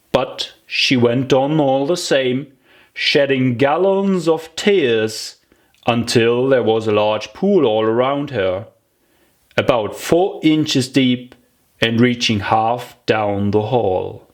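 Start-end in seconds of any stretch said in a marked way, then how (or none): none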